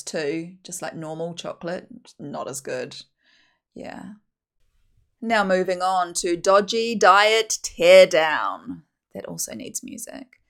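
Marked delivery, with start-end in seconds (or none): 5.2-8.8 slow, loud segment announcement; announcer voice. Acted. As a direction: announce it like a show host.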